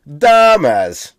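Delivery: loud, drawn-out first syllable on "dumbass"; Chicago-style nickname. Shown as a demonstration. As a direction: stretch DUM in "dumbass" like "Da Bears". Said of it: "it's just a reference to".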